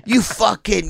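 high-pitched mock cursing; angry insult. Customer at the car cursing narrator, mock angry. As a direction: imitate an irate customer.

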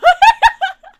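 high loud screech of laughter; shrieking laugh. Real, not acted. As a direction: scream with laughter.

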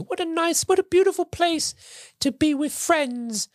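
high, slow, tender elf squeak; dying Dobby. Retold or imitated. Dobby dying in Harry's arms, slow high squeak. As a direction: squeak it slow with fading breath. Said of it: "Dobby says the iconic".

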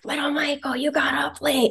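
high mocking scold; mock scolding parent. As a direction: mimic a nagging parent. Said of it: "we're like".

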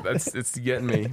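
ordinary delivery

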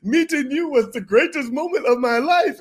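high, loud bowing gush; groveling superfan. Retold or imitated. Bathroom attendant bowing to the prince, high and fawning. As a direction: fawn over him like royalty. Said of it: "he's like bowing".

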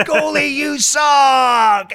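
high, long drawn-out fan shout; stretched-out imitation terrace yell; mock heckle. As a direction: holler it slowly across the pitch, imitating a heckler. Same heckler shouting once more.

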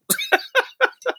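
sharp high giggle; high laugh. Real, not acted. Laughing after joking about hell with the fun people.

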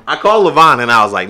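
ordinary talk

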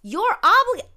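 loud, high, incredulous; outraged. Real, not acted. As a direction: shout it in disbelief. Outraged at cops.

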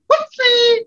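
very high, loud squeal; squeaky Toasty voice. Retold or imitated. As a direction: shriek it cartoonishly. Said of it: "pop out from the side and go".

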